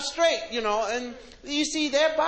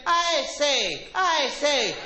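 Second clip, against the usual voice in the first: mock English; 2 semitones higher, much slower than usual. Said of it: "this Englishman kept saying".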